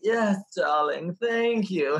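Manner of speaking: mock diva accent, slow on darling; lightly imitating a fake diva accent; [name]'s put-on accent. Imitating Mariah answering fans in her put-on diva accent. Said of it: "where did this accent come from".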